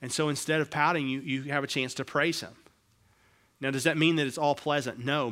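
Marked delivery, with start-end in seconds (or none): none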